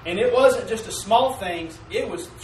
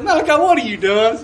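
calling out to son; 4 semitones higher, 9 dB louder than usual. Retold or imitated. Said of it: "And I said".

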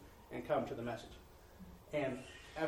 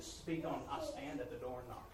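much softer, hushed and low; quiet voice of the Lord; 3 semitones lower, 10 dB quieter than usual. Retold or imitated. Preacher quoting the Lord's word to him, softly. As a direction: speak the Lord's words gently.

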